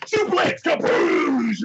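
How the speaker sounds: high mock shout, drawn out at the end; hammed-up wrestling call; wrestler's battle cry. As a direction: yell the move like an attack. Wrestler calls out his move.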